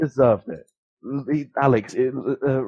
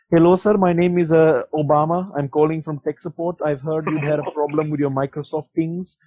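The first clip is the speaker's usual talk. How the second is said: put-on scam caller in a higher Indian accent; 4 semitones higher, 3 dB louder than usual. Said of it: "Indian guys who just call you up".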